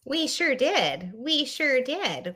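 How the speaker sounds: slow, drawn-out Midwestern "we sure did"; Midwestern accent. Pretend. Host showing off her Midwestern mom voice to co-host. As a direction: lay the Midwestern accent on thick. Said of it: "we became the Midwestern accents".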